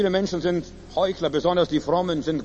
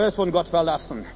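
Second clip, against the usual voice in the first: ordinary talk, nothing marked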